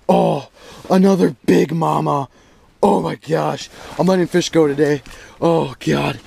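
ordinary talk

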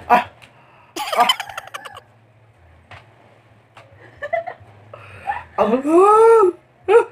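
loud burning-mouth yell; pained yells from spiciness. Real, not acted. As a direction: cry out from the spicy burn.

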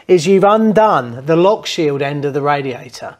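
usual speaking voice

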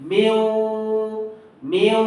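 মিউ with the ending sustained on a level note; held light ghunna. Shown as a demonstration. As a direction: hold the tail of মিউ. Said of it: "মিউ ধরে রাখলে হয়ে যাবে". (হালকা গুন্নায় মিউ টেনে ধরা)

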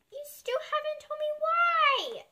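high, slow complaining plea; a child crying out for a reason; plaintive cry. A narrator's reading. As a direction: protest with a high whine. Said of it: "cries Coco".

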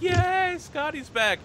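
high-pitched mock crowd cheer; cheering audience. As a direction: act out excited fans cheering in a high voice. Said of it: "everyone in the audience is like".

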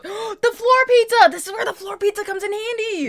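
high, thrilled burst after a gasp; excited realization. Real, not acted. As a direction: announce it like a sudden brilliant idea. Sudden delighted idea about floor pizza.

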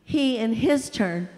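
ordinary delivery, nothing marked

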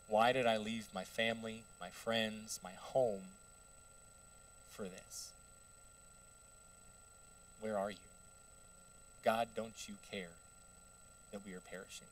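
low, subdued pleading; quiet lament. Acted. Disciple regretting leaving home, pleading quietly with God.

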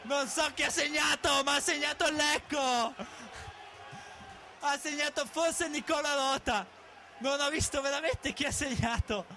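screaming goal call, high and loud; breathless italian goal commentary. Real, not acted. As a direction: scream the winner, drawn out. Last-minute winning goal.

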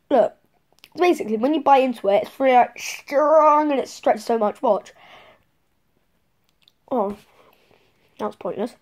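talking while biting candy; chewy candy between teeth; mouth full of candy. Real, not acted. Seen 2: holds gummy worm in teeth, biting and stretching it while talking.

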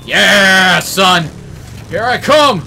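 loud battle cry while charging; triumphant yell. Real, not acted. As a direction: roar as you charge in. Charging in with the vehicle.

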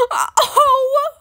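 loud, high-pitched wailed "oh"; mock happy crying. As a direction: wail as if moved to tears. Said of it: "I'm gonna cry".

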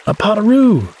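high, startled exclamation; surprised gasp. A narrator's reading. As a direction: blurt it out on suddenly spotting the animal. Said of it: "Bluey looks up and gasps".

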